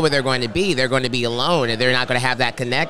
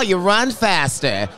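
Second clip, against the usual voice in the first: ordinary talk, nothing marked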